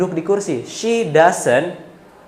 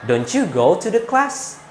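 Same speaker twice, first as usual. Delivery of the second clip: doubtful question; 3 semitones higher, slower than usual. Shown as a demonstration. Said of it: "agak kurang meyakinkan".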